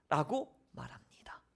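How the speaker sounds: whispered aside; 라고 말합니다 whispered, not voiced. Shown as a demonstration. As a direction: whisper the closing tag. Whispered closing tag.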